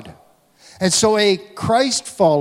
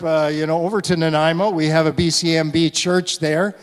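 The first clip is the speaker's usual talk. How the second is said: ordinary talk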